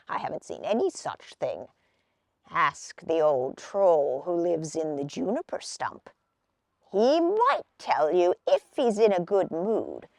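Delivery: put-on gruff advice from an old man. A narrator's reading.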